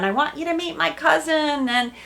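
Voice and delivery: high, loud, eager gushing; imitating an excited boyfriend going full on; eager rush. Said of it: "He's going full on".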